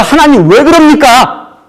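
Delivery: high, loud protest to God; angry Jonah. Retold or imitated. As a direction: cry out at God in anger. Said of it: "화가 막 난 거예요". (하나님께 따지는 요나, 높고 큰 항의)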